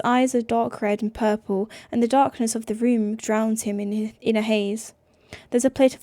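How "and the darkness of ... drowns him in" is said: ordinary delivery, nothing marked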